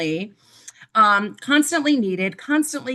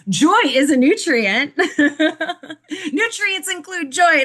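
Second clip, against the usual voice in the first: giggling high and loud through the words; real giggle after tripping over the word; laughing at herself; 7 semitones higher, 4 dB louder, slower than usual. Real, not acted. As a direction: laugh off the flub mid-phrase. Giggling through a flubbed line.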